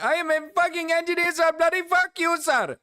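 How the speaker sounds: lightly put-on Indian accent, insisting. Mocking the clip's engineer in a light Indian accent. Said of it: "Sir, I am fucking Indian".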